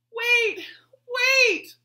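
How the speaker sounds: high, loud pleading call. Acted. Betsy pleading with the running girl, loud and high. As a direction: plead with her to stop. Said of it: "pleaded Betsy".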